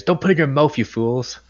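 in-character yell. Acted. Officer to the younger engineers, moderately yelled scolding. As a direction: yell a warning, easing off at the end. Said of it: "I'm yelling at the younger engineers".

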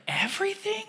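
high, soft disbelieving "Everything?"; quietly floored by the idea; incredulous. Retold or imitated. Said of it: "And I was thinking to myself".

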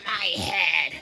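normal talking voice

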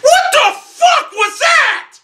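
very high, slow, loud shriek of disbelief; incredulous high-pitched yell; outraged scream. Real, not acted. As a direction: scream it out, stretching each word. Gamer screams after losing.

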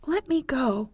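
high-pitched, mild command; ordering. A narrator's reading. Wendy is drawn toward the window by Peter, ordering him. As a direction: order him to release you. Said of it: "she ordered him".

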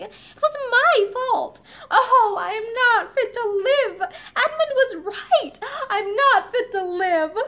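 slow, crying and breaking; weeping self-blame. A narrator's reading. Linda blaming herself to Barbara, weeping. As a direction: cry out your guilt.

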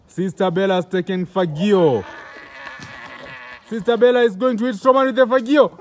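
normal talking voice

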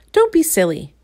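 high, sharp dismissive cry; stubborn girl dismisses the worm's warning; cried out. A narrator's reading. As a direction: brush the worm off loudly. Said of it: "cried Little Miss Stubborn".

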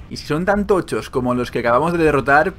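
usual speaking voice

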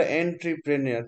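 "এন্ট্রিপ্রেনিয়র" read as spelt, en-tri-pre-ni-or; spelling pronunciation. Shown as a demonstration. Teacher gives students the spelling, "এন্ট্রিপ্রেনিয়র" said letter-true as en-tri-pre-ni-or. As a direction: sound out "এন্ট্রিপ্রেনিয়র" with an "en" start. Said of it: "বানানটা".

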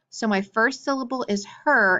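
ordinary delivery

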